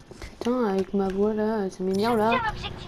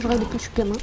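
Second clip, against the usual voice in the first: ordinary talk, nothing marked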